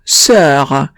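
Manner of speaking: sœur with the rounded French eu and a French r; sœur in clean French. Shown as a demonstration. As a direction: model sœur as one rounded vowel.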